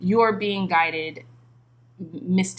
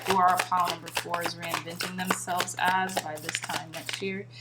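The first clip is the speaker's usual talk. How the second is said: ordinary delivery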